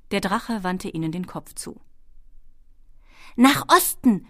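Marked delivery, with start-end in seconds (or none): 3.4-4.3 high, loud kobold shout; calling out. A narrator's reading. Kobold navigator directs the dragon in flight, calling loudly. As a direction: call the heading up to the dragon. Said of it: "rief Schwefelfell ihm zu".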